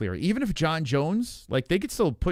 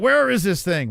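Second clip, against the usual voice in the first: loud, raised mock panic; frantic yell; 6 semitones higher, 6 dB louder than usual. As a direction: shout it while tearing through garbage. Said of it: "Two joints like".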